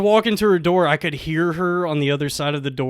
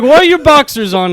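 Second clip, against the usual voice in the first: loud, high mock Russian woman; pitched-up put-on Russian; thick Russian accent; 9 semitones higher, 9 dB louder than usual. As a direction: demand it in an imitated Russian accent. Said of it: "she has, like, a thick Russian accent".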